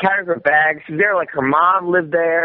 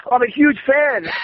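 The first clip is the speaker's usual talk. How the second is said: starstruck gush; 9 semitones higher than usual. Retold or imitated. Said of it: "I was, like, starstruck".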